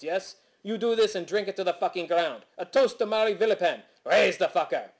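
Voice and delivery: really bad fake French-Canadian accent, hammed up; exaggerated Quebecois accent. Landlord phones his tenants and cusses eavesdroppers, exaggerated Quebecois accent. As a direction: play a caricature Quebecois landlord ranting down the phone. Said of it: "an absurdum of exaggerated Quebecois".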